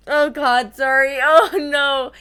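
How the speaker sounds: real embarrassed laugh at a slip. Real, not acted. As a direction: laugh through it, caught out. Amused at own reading slip.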